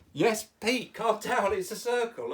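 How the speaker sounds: firm, humouring reassurance; placating. Real, not acted. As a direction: talk the excited puppet down. Teacher calming the overexcited puppet.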